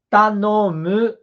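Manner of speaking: teacher models Tanomu slowly; slow word demo. Shown as a demonstration. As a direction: stretch out Tanomu to show the u ending. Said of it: "também está com som de U".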